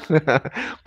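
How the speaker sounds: short, soft chuckle; light chuckle. Real, not acted. Speaker seeking agreement about Malaysia, light chuckle. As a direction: chuckle briefly after making the point.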